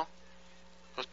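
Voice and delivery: ordinary talk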